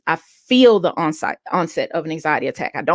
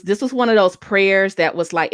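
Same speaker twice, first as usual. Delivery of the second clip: usual speaking voice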